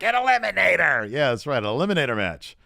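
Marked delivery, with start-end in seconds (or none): 0.0-1.1 high, loud mock promo line; hammed-up wrestler impression; imitating Austin. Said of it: "And Austin's like".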